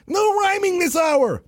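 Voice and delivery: high, slow scold; high mock host; Vizzini scolding. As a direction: snap it from up high.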